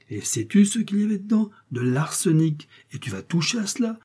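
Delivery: high-pitched, scandalised questioning; indignant interrogation. A narrator's reading. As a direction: grill the boy, pitch leaping up. Apothecary's menacing questioning of boy. (interrogatoire indigné, voix aiguë)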